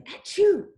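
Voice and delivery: a big pretend achoo; sneeze. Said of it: "she's saying".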